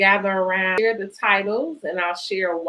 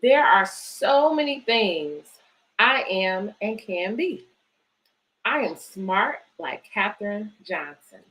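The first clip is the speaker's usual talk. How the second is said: put-on reading to children. Reads picture book aloud.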